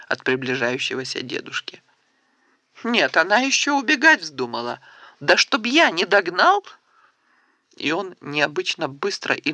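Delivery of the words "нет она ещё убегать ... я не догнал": higher, louder mock scolding; hammed-up grumpy chase; playful threat. A narrator's reading. Grandpa playfully chases girl. (шутливая угроза)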